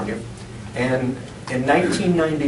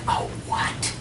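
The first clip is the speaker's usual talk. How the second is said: whisper; 9 dB quieter than usual. Retold or imitated. Wife whispers in confusion.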